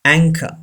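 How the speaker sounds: anchor as "ANG-ka", no R at the end; anchor, stressed first, ending in "ka"; silent final R. Shown as a demonstration. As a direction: say anchor with CH as k and drop the R. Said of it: "R is not pronounced".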